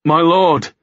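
sudden outburst to a superior; bursting out. A narrator's reading. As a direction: blurt it out in alarm. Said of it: "he burst out".

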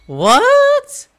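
ordinary talk

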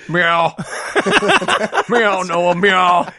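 mock cat meowing, the last one called out; playful meowing, the last meow shouted. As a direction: meow like a cat, rising to a call. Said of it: "Wilford Brimley wearing cat ears".

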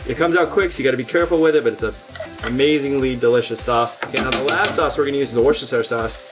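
ordinary talk

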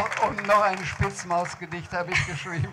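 laughing while talking. Real, not acted. Author tells interviewer about poem, laughing. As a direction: talk through the giggles.

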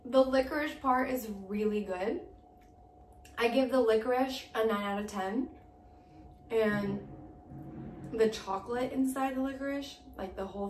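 talking while chewing candy; muffled chewy speech; mouth full, chewing. Real, not acted. Seen 0: Holds licorice piece at mouth, bites at 2.5, jaw working throughout.

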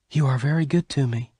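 high-pitched, gently grateful character voice; Bess, tender. Acted. Bess thanks Venters before he leaves, tender.

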